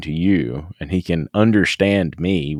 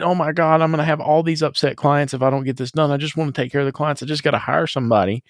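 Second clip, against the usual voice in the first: rushed, high fretting inner voice; higher, hurried mock worry; mock panic; 6 semitones higher, faster than usual. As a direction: fret fast like a swamped owner. Imitating own panicked thinking.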